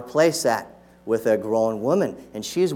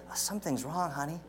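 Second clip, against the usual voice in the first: acted worried parent, much softer; hushed mock concern; 15 dB quieter, faster than usual. Parent confiding in spouse about the baby, hushed acted concern. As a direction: drop to a soft, confiding aside.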